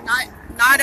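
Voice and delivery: ordinary delivery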